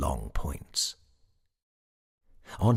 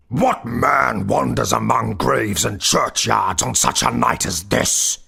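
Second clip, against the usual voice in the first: loud, raised goblin voice; goblin's cry; 5 semitones higher, 10 dB louder than usual. A narrator's reading. Goblin challenges the sexton among the graves, crying out. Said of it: "cried the goblin".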